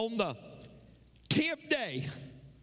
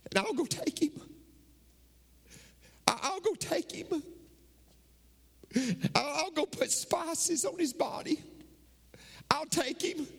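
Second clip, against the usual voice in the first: Mary pleading; 7 semitones higher than usual. Acted. Mary voiced pleading for body.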